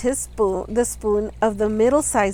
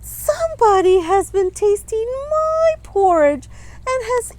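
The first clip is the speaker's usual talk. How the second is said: much higher tiny crying voice; little wee bear voice; 12 semitones higher, 4 dB louder than usual. A narrator's reading. Little bear wails to his parents, high tiny cry. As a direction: squeak it out like a tiny upset cub. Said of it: "cried the little wee bear in his little wee voice".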